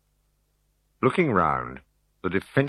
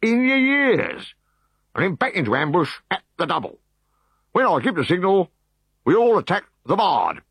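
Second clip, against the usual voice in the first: high, raised officer commands; rally the troops for the ambush; officer's orders; 11 semitones higher, 4 dB louder than usual. Acted. Decurion briefing the ambush, high and raised.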